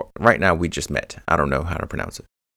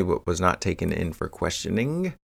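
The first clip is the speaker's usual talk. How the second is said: ordinary talk, nothing marked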